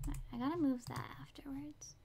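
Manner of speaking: quiet whispered aside. Real, not acted. Streamer noting a reminder to herself, whispered. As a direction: murmur it under the breath.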